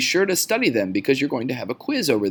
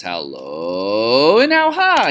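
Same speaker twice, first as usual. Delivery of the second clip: exaggerated inflection; 6 dB louder, much slower than usual. Shown as a demonstration. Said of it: "talk about is inflection".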